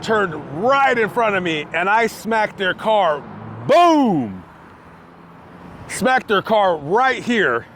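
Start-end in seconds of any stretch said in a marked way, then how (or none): 3.7-4.4 loud boom of the impact; mock crash boom. Retelling the crash to viewers, a loud mock boom. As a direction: punch out the crash noise.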